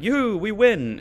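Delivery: high, loud playful victory whoop; mock celebration. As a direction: cheer like a pretend winner. Acting out a victory cheer.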